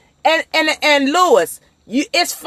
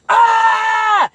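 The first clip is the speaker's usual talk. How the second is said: yell out the window; 9 semitones higher than usual. Shown as a demonstration. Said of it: "Roll your window down and be like".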